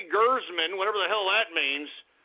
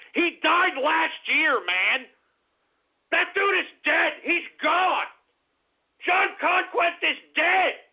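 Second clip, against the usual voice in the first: higher, raised exasperated insistence; heated insistence; 5 semitones higher, slower than usual. Real, not acted. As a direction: hammer it home in frustration. Insisting a rumor is false.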